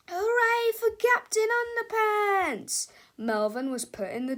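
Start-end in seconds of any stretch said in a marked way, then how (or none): 0.1-2.9 high, loud, slow hooray; big cheer. Acted. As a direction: cheer it out triumphantly. Cheer ending the comic.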